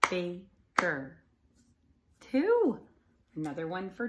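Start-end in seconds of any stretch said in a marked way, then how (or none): 0.1-1.2 Baker in two loud beats; name clapped out. Shown as a demonstration. Teacher clapping out Baker's name in beats. 2.2-2.8 much higher, loud cheer; excited count. Shown as a demonstration. Praising children's answer.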